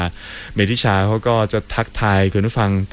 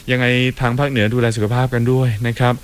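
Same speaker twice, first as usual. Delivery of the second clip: normal talking voice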